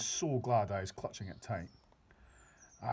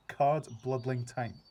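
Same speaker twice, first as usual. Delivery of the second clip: very slow, louder stress on card-bloodling time; emphatic drawl; 2 semitones higher, 6 dB louder, much slower than usual. A narrator's reading. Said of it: "the B F G cried".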